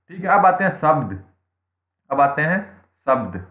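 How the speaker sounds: ordinary talk, nothing marked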